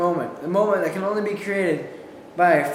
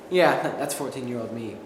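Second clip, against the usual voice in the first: ordinary talk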